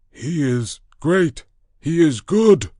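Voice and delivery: high, loud awed beast voice; ape-man creature voice. Acted. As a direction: cry it upward in fearful worship. Ape-man in fearful awe.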